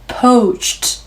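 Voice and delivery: "Poached" ending in a voiceless t burst; poached with soft ch ending. Shown as a demonstration. Teacher demonstrating the voiceless ending of "Poached". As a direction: release "Poached" with a breathy t at the end. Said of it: "치 소리로 끝이 나면".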